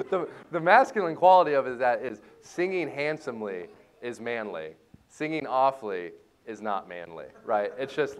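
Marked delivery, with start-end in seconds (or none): none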